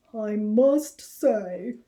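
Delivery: a big rabbit's slow voice; Harold's voice. Acted. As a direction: Harold speaks up slowly. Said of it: "said Harold".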